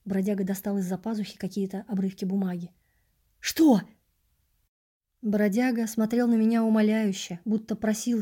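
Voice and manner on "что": startled, louder and higher; sharp what. Acted. Narrator startled by the vagrant's scraps of paper. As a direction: jolt out the question.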